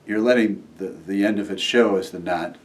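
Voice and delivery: ordinary talk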